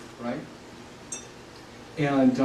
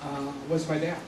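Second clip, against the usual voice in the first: real tears held back, much softer; choked up; 8 dB quieter than usual. Real, not acted. Speaking of his late dad to the audience, choked up and quiet. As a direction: voice catching as he talks about his late father. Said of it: "I get choked up".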